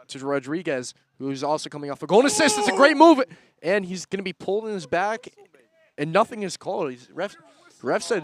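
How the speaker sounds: loud, high running commentary, peaking in a yell; excited play-by-play. Real, not acted. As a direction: call every pass as the move builds. Live play-by-play of the move.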